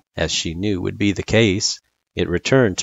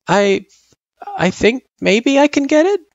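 put-on high, hesitant kid voice; hopeful; 5 dB louder than usual. A narrator's reading. Frisk about to try division, hopeful. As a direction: start haltingly, then lift with tentative hope. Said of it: "she said hopefully".

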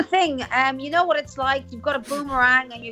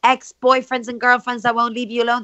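ordinary delivery

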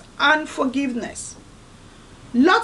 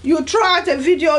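ordinary talk, nothing marked

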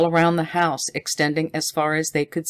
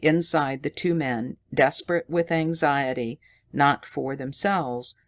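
ordinary delivery, nothing marked

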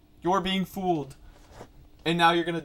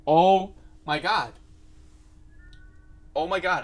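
shocked; 8 dB louder than usual. Real, not acted. Reacting to horror video.

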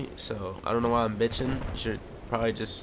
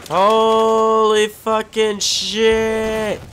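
drawn-out high yell, much louder; startled shout; 10 semitones higher, 10 dB louder, much slower than usual. Real, not acted. Player caught off guard by an enemy, yelling in alarm. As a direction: bellow it out in real shock. Startled mid-game by sudden danger.

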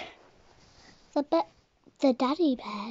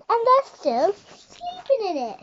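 put-on much higher baby bear voice; 9 semitones higher, 3 dB louder than usual. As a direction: squeak it out urgently.

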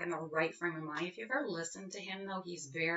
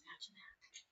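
softer, whispered wonder; whispered aside; 19 dB quieter than usual. Acted. Mid-poem aside to listeners, hushed whisper. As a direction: lean in and breathe it.

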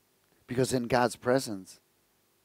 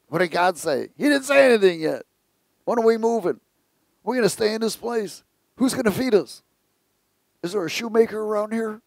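higher, whiny voices of complaining people; nagging crowd; 8 semitones higher, 3 dB louder than usual. Retold or imitated. People pestering Moses, in mock whiny voices. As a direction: pester him like an impatient crowd.

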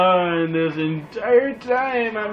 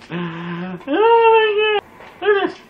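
amazed; 7 dB louder, much slower than usual. Real, not acted. Amazed at game.

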